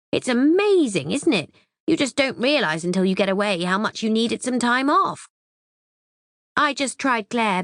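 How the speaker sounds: a breezy mum calling from holiday; high cheerful mother on the phone; Mum's voice. Acted.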